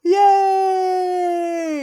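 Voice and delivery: long, loud, high-pitched yay; excited cheer. Real, not acted. Co-host celebrates the newest Demon Slayer movie, excited cheer.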